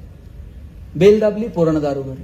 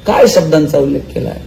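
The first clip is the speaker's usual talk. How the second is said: normal talking voice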